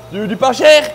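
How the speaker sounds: loud, high, manic ad voice; wild unhinged ranting. Retold or imitated. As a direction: yell it like a crazy YouTuber. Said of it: "c'est le Tomahawk qui dit ça". (voix de fou criée et aiguë)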